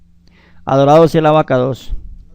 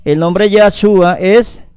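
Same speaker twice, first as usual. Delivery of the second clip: ordinary delivery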